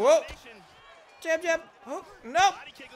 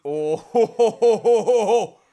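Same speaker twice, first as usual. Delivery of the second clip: triumphant hoho laugh; 7 semitones higher, 12 dB louder than usual. Real, not acted.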